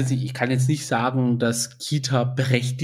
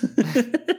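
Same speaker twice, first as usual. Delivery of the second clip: high laugh; 12 semitones higher than usual. Real, not acted. Laughing about the fierce callout.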